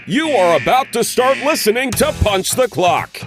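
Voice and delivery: usual speaking voice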